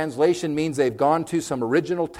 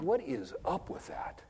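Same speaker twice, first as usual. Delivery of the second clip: hushed, puzzled aside; bemused whisper; 13 dB quieter, slower than usual. Retold or imitated. Preacher reacting to stiff prayers, puzzled whisper. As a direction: whisper your disbelief. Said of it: "I'm just like".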